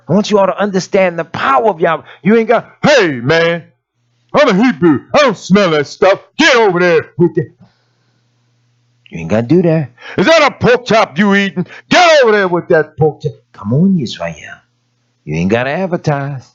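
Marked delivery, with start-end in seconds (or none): 2.8-7.5 a hint of a loud, preachy brag; mock showy believer. 10.2-13.3 a touch louder, higher scolding brag; mock showy believer.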